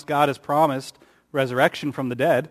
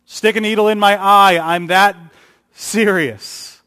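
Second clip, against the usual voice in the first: insistent oath; 5 semitones higher, 7 dB louder than usual. Acted. Peter's oath continues.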